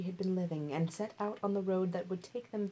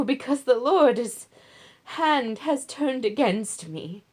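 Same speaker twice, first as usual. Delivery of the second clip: put-on anguished old widow; 6 semitones higher, 9 dB louder than usual. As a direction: start tender, then cry out her bitterness. Naomi urges daughters to return.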